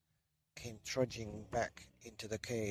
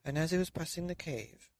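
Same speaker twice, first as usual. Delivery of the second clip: plain reading voice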